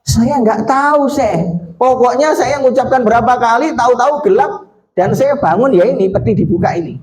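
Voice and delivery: lightly voiced boy, high pitch; innocent child. Retells the boy's innocent answer.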